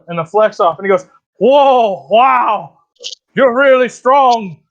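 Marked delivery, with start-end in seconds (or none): 1.4-4.6 high, loud, drawn-out awe; impressed bear. Acted. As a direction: marvel at the winner of a flex-off. Bear loses a flex-off, impressed.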